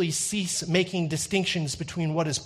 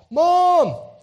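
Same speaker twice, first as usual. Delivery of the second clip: loud, high, drawn-out call; yell down the hall; 11 dB louder than usual. Retold or imitated. Tattling kid shouts down the hall for Mom, loud high yell. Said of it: "began to yell down the hall".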